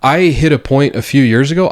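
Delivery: usual speaking voice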